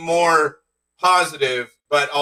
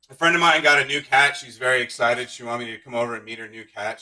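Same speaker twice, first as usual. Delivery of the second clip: ordinary talk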